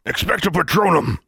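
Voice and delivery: high, loud incantation; ringing battle cry of a wizard, pitched high; shouted spell. A narrator's reading. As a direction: shout the charm with full force. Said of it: "Then someone shouted".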